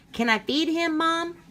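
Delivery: put-on high, pleading little kid voice; a child coaxing mom, voice rising; eager child.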